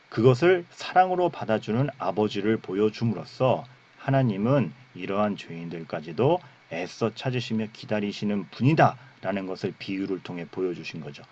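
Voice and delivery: ordinary talk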